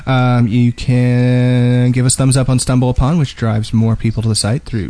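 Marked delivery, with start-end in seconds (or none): none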